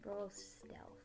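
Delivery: very soft stealthy whisper; hushed whisper. Pretend. Game master answering Steve sneaking out, hushed whisper. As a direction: whisper it as if not to be caught.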